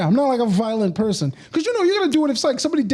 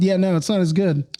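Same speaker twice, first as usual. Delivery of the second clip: ordinary delivery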